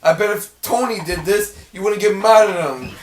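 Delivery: high, loud sulky complaint; whiny kid. Retold or imitated. Imitating the cartoon son complaining to Ma, loud whine. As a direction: sulk like a jealous brother. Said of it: "I was like".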